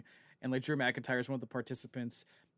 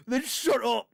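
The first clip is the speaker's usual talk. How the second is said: sharp mock yell, higher and louder; imitating a shout; 8 semitones higher, 5 dB louder than usual. Retelling Drew's promo to his co-host, a mock shouted put-down. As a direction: bark it like a fed-up wrestler cutting someone off. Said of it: "And Drew McIntyre just goes".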